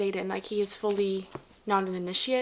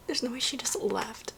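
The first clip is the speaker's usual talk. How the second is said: whisper; 2 semitones higher than usual. Real, not acted. Stunned reader reacting to the book.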